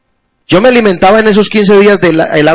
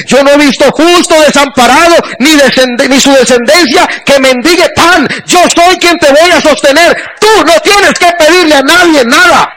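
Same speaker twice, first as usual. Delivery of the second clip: emocionado; 7 semitones higher than usual. Real, not acted. Cita a Dios con emoción.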